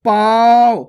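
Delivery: पाँव loud, long and through nose and mouth; nasalised vowel. Shown as a demonstration. Teacher modelling for learners, loud long nasalised पाँव. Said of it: "चंद्र बिंदु की मात्रा".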